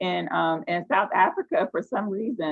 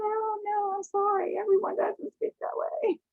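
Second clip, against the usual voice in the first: high-pitched mock apology; amused protest. Actor telling casting people they are mistaken, high amused protest. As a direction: object in a high, amused little voice.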